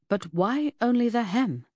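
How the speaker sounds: pressing. A narrator's reading. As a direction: press for an answer. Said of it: "I pressed".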